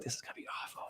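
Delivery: whispered under the breath; whispered dread. Retold or imitated. Teller whispering his own dread at the Spam, whispered. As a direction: mutter it in a whisper like a bad omen. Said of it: "I was like".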